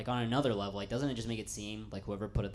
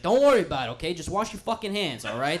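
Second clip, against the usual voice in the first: pushy owner; 9 semitones higher, 14 dB louder than usual. Retold or imitated. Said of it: "He's like".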